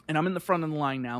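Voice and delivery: ordinary talk, nothing marked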